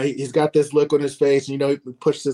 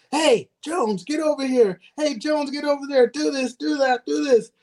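imitating coach yelling; 11 semitones higher than usual. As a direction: bark orders across the field, a mock yell pitched high. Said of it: "he's just screaming across the field".

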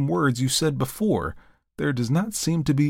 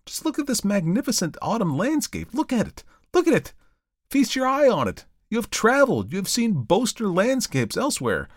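shouted; 10 semitones higher than usual. A narrator's reading. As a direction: rouse him, excited and insistent.

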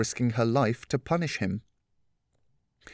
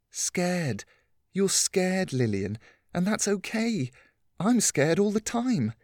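put-on tender older friend, higher and hushed; comforting Catherine; 8 semitones higher, slower than usual. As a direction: tell her it's alright to be afraid.

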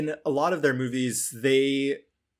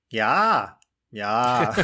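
put-on long drawn-out Fargo accent; comic Fargo-accent impression; imitated Fargo accent; 4 semitones lower than usual. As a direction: stretch it out like a Fargo local. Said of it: "the comedy of this movie is fully just like".

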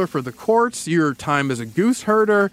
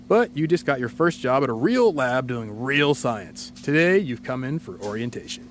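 lower, calm storyteller voice over music; guided imagery narration; 4 semitones lower, 3 dB quieter than usual. Acted. Sets a guided imagery scene.

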